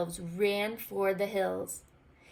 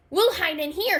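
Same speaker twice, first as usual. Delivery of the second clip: Goblin voice; 10 semitones higher, 9 dB louder than usual. A narrator's reading. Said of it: "said Goblin".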